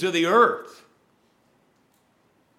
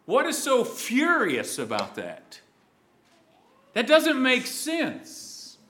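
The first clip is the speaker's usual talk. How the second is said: 1 mock puzzlement; 6 semitones higher than usual. Rhetorical disbelief at the decree.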